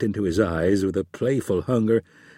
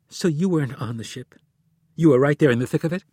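fast, louder incredulous; disbelief; 5 semitones higher, 4 dB louder, faster than usual. Acted. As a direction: catch him in the lie. Wife realizing the truth.

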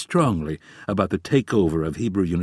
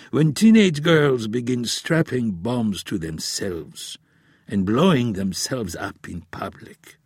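put-on grave old professor; 3 semitones higher than usual. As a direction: warn like a weary elder.

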